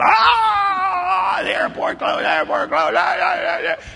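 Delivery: high, loud hammed-up screams; mock panic. Preacher mimicking the panicked hotel guests, hammed-up screams. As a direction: ham up the frightened crowd.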